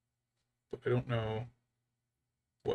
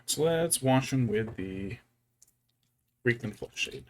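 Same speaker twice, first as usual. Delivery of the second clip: ordinary talk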